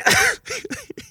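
squeaky high laugh; high giggle. Retold or imitated. Laughing at child's question.

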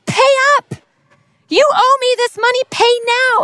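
high, pushy demand for money; angry demand. Acted. Servant throttling his friend, demanding angrily. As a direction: choke the debt out of him. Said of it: "grabs him by the throat and starts choking him".

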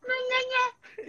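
muy agudo y lento, alargado; estíralo agudísimo para asustar; imitando el susto. Retold or imitated. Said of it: "Entonces nos asusta". (susto agudo y lento)

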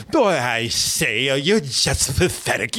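slow, hammed-up fake British accent; parody British butler accent. Mocking the actor as a Brit Butler, drawn-out fake British accent. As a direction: draw out a bad American take on a British butler. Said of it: "sound like American doing the Brit Butler".

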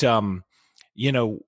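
usual speaking voice

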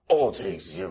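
gravel-free lightly voiced Macho Man; a mild stab at the Macho Man growl; half-hearted wrestler promo voice; terrible Macho Man impression. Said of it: "I'm doing terrible Macho Man impression".

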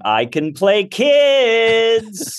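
high, loud, very slow call; hammed-up calling out; mock desperate pitch. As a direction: holler it down the hallway, stretched out. Acts pitching self round offices.

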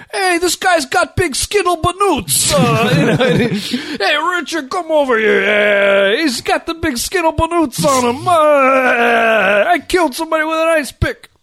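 hammed-up mobster accent; fake Italian mobster. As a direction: Richard Pryor's fake mob bit, laughing through it. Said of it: "Richard Pryor bit ... fake Italian words".

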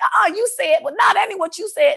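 high-pitched, quick back-and-forth objection; mock argumentative protest; retold bickering. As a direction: replay a table debate, voice jumping up. Acted dinner table debate.

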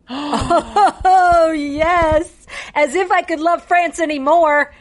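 high, excited swoon over France; gushing to her cohost about the home of champagne; delighted gush. Real, not acted.

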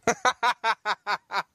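high-pitched run of laughter; real laugh. Real, not acted. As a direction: laugh it out in a high, rapid string. Amused retelling of the failed glass break.

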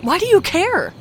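high, loud, strained question; frantic, strained plea; desperate cry. Acted. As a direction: plead through the fear. Victim pleads with attacker.

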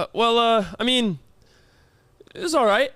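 reluctant answer that tilts up at the end; hesitant. Retold or imitated. Client fibbing to the desk woman, hesitant. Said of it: "you hesitated".

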